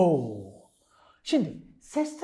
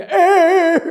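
loud startled yelp; 6 semitones higher, 9 dB louder than usual. Acted. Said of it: "Annem odaya gelince".

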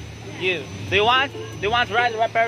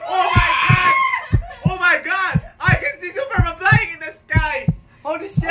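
high, loud thrilled shouts; thrilled yelling from the top of the ride; excited yelling; 9 semitones higher, 4 dB louder than usual. Real, not acted. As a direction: shout it out in wonder. Yelling excitedly from the ferris wheel.

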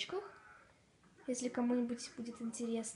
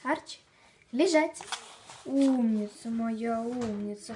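loud dog commands; 6 dB louder, slower than usual. Real, not acted.

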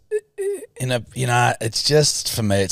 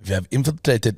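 a hint of a mock Russian accent; bad Russian accent. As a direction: play a clumsy Russian spy on the phone. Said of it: "what's a Russian accent?".

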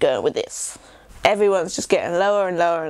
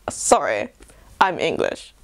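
a posh English apology; posh English accent; 5 semitones lower than usual. Shown as a demonstration. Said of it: "the posh sides".

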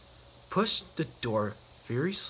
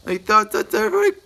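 laughing narration; 5 semitones higher, 9 dB louder than usual. A narrator's reading.

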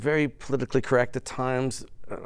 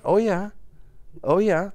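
polite Canadian sister-in-law; 9 semitones higher than usual. Acted. Sister-in-law politely following an American's story, high Oh, yeah. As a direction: chime in high to show you're listening. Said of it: "she was being polite".

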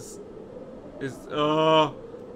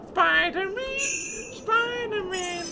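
superhero call; 12 semitones higher, 4 dB quieter, slower than usual. Pretend. Playing hero after big jump.

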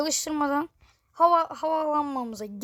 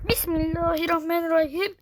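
ordinary delivery

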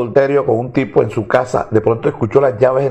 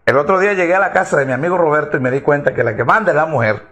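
normal talking voice

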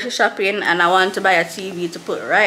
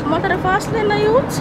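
calling out at driver; 13 semitones higher than usual. Real, not acted. Yelling at a driver.

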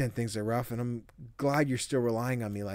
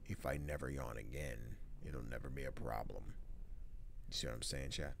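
much lower and softer, flat murmur; deadpan low joke; 10 semitones lower, 14 dB quieter than usual. Real, not acted. Streamer jokes darkly to chat in a low murmur. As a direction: drop the voice for a dark joke.

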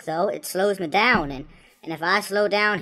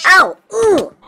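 high, loud shocked cries; sudden high-pitched outburst; startled yelps; 13 semitones higher, 13 dB louder than usual. Real, not acted. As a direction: yelp as if caught by surprise. Hunter sneaks up.

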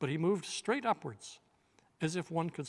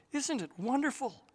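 delighted; 9 semitones higher than usual. Acted. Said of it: "said Lucy".

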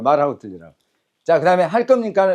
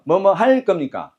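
normal talking voice

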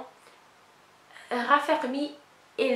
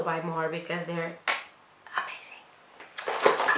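slow breathy whisper of amazing; 5 semitones lower, 6 dB quieter, slower than usual. Real, not acted. Whispering how good the eye patches are. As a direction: let the word fall to a hushed whisper.

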